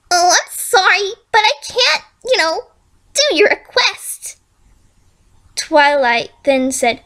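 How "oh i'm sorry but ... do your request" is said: mock sorry. Villain mock apology.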